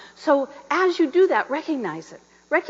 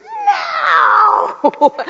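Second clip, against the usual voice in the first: sudden high shouted no; inner cry of no; 6 dB louder than usual. Retold or imitated. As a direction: burst out with a panicked, high shout. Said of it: "Every fiber of my being is going".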